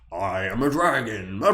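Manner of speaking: slow, lightly voiced Nixon dragon; a hint of Nixon, slow; joking dragon impression like Nixon. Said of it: "the dragon sounds like Nixon".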